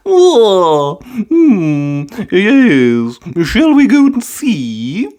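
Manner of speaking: high, slow granny voice; a cheery old grandma, curious; Granny Pig's voice. A narrator's reading. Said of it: "said Granny Pig".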